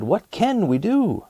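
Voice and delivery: high, slow mock villager cry; a hint of worried villagers calling out. Villagers beg for help from the narrator, mildly fretful. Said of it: "the villagers cried".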